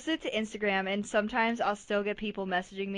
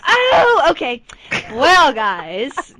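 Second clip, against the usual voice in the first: much louder, high, addressing the audience; loud announcement; 4 semitones higher, 16 dB louder, much slower than usual. Real, not acted. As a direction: boom it out to the listeners. Announcing costume to listeners.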